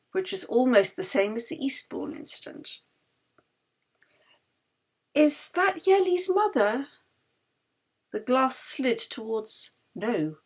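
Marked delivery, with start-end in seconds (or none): 5.1-6.9 character voice. Acted. Character asks at seance.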